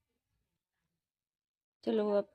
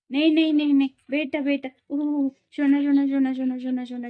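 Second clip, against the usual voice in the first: loud soothing; 5 semitones higher, 11 dB louder than usual. Real, not acted. Mother calming fussing baby.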